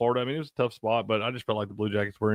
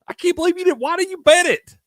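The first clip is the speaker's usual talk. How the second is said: much higher, louder disbelieving cry; exasperated outcry; 6 dB louder than usual. Retold or imitated. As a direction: shriek it at your friend in disbelief. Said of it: "and I was like".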